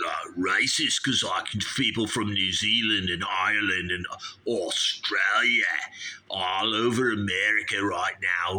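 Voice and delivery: hammed-up Aussie argument; Australian accent. Immigrant snake handler argues with radio host, mock Australian accent. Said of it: "he's from Australia".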